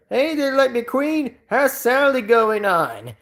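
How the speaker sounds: high-pitched Southern cartoon character; hammed-up folksy Southern impression; Mater Southern accent. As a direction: call out a friendly question in a high, drawling Southern voice. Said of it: "if I did that Mater accent anyways".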